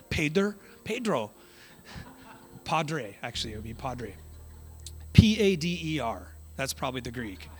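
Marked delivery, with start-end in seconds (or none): 0.1-1.3 lightly put-on Mexican accent, pitched higher; mock Mexican accent. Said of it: "I say it like a Mexican". 5.1-6.3 loud spelled-out letters; emphatic spelling out. Real, not acted. Spelling the word out loudly.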